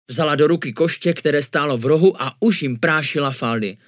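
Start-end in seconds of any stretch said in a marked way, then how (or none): none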